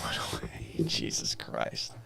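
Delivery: whispered, with a tired laugh; hushed, worn-out muttering; defeated whisper. Real, not acted. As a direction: breathe it out, beaten down. Exasperated at the echo again.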